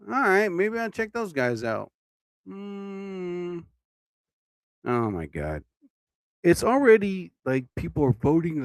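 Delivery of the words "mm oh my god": sleepy yawn into the words. Real, not acted. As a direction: let the yawn swallow it. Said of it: "I am yawning like crazy".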